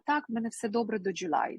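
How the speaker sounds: ordinary talk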